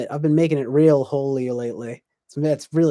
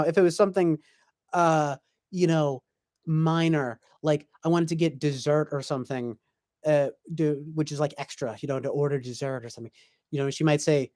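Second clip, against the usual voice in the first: ordinary delivery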